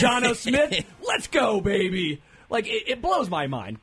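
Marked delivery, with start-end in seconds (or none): 0.0-2.2 mock hype. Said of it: "Desmond Ritter's like".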